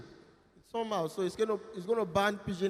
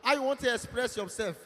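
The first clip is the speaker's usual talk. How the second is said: ordinary delivery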